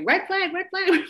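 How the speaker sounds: high, urgent warning chant; alarm voice. Retold or imitated. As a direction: sound the alarm in your head.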